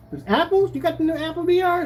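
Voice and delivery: high-pitched, eager question; excited passerby. Acted. Stranger spotting the dark glasses, mock excited. Voices a passerby asking about headset.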